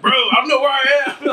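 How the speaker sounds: high, loud panicked laugh; lost and laughing in panic. Retold or imitated. Lost son telling stepdad, high laughing panic. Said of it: "And I'm like".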